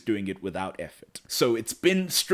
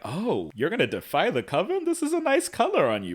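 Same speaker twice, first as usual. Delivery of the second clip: high, swooping mock-impressed voice; teasing sister; 8 semitones higher than usual. Sister reacting to her brother's rebellion, high and swooping. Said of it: "And she's like".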